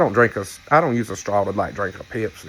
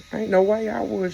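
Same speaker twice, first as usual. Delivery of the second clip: emphatic refusal; 10 semitones higher than usual. Retold or imitated. Telling followers she'd never overshare, emphatic refusal. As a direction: refuse it flat out, pitch climbing. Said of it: "And I'm like".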